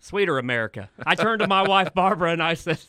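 lightly voiced folksy president; George Bush. Joking to the podcast guys about his trainer, light presidential impression. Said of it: "a little Barbara Bush in there with the George".

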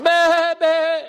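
very high, startled bleat; shocked lamb bleat. Acted. Shocked lamb crying to its mother, very high startled bleat. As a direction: yelp it out like a jolted lamb.

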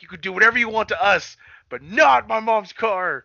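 hammed-up plea to spare mom's car; mock pleading. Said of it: "then Trent's like".

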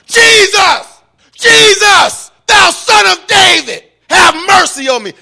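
very high, full-force scream for mercy. Acted. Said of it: "he cried out the more".

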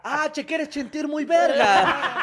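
grito agudo y lento de burla; porra gritada. Pretend. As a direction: grita burlándose como porra. Porra burlona. (porra burlona gritada)

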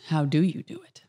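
whisper. Real, not acted. Co-host echoing the question in a whisper. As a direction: whisper it back to yourself.

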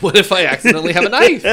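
loud, high, fast through laughter; laughing outburst. Real, not acted. Podcaster echoing co-host's knife joke, laughing loudly. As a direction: blurt it out, cracking up.

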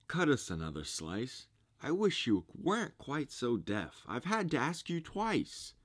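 a hint of a grumbling carpenter; grumpy carpenter. A narrator's reading.

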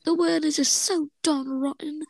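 high, slow, mock-miserable voice; three D Squelden. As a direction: draw it out in a high cartoon lament. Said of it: "is very upset".

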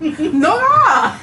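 very high, loud shriek of God. Real, not acted. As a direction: squeal it in disbelief. S0 squeals with laughing outrage.

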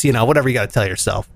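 ordinary talk

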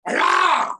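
high, loud rallying cry; excited yell. Retold or imitated. Crew fired up on set, excited yell. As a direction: let out a burst of energy.

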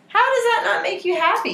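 high delighted gush; excited. Real, not acted. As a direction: squeal it with joy. Gushing over fat animals.

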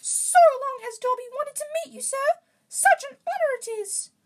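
very high, loud squeaky elf; Dobby. A narrator's reading. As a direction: squeak excitedly in a high falsetto. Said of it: "in such a high-pitched voice".

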